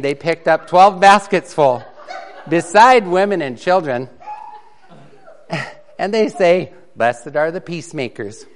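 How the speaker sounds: lively, loud storytelling read; comic story reading. Acted. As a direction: read the mixed-up Bible tale for laughs. Reads a comic mixed-up Bible story.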